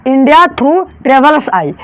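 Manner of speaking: each word of India through travelers eye drawn out slowly; slow, spelled-out title. Shown as a demonstration. Teacher slowly spelling out the title for students. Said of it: "आई से यहाँ पर क्या हो गया".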